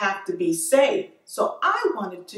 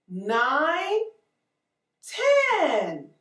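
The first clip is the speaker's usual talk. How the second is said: put-on counting for kids; much slower than usual. Shown as a demonstration. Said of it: "Let's count the pumpkins".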